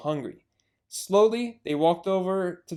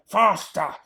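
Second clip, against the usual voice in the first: louder each time; shouting; 4 semitones higher, 8 dB louder, much slower than usual. Acted. Bogus urging the drivers, building shouts. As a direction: bark it at the drivers. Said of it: "hopping around ... and shouting".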